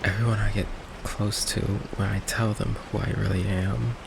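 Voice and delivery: soft, halting and resigned; quiet hurt. Acted. Vampire confessing that loved ones always leave, quiet hurt. Confesses everyone close runs away.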